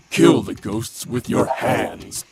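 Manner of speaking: ordinary delivery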